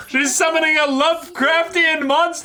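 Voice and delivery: high pitched, loud stunned outburst; shocked disbelief. Real, not acted. Reacting to the anime girl summoning a monster, shocked high outburst.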